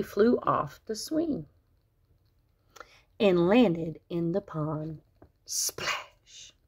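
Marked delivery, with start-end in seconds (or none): 5.4-6.5 breathy whispered splash; hushed, voiceless water sound; whispered sound effect. A narrator's reading. Sound of landing in pond.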